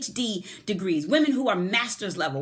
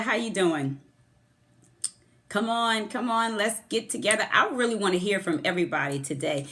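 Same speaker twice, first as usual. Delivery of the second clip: usual speaking voice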